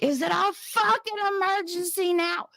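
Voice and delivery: very high, furious shriek; furious outburst. Retold or imitated. Mother confronting the nurse after the puke, furious outburst. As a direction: scream it as a bitter challenge.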